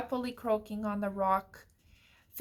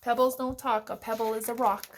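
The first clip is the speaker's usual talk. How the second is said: usual speaking voice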